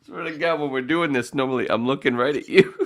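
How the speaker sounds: high-pitched, giggly protest; holding back laughter. Real, not acted. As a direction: struggle to explain without cracking up. Trying to talk after laughing fit.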